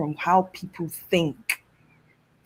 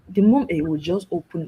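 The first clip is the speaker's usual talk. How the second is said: usual speaking voice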